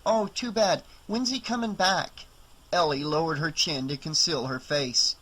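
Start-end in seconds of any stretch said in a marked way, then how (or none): 0.1-2.3 put-on higher kid's voice, polite; young boy. Boy asks Ellie for the owner, higher kid's voice. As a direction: play a small boy asking politely.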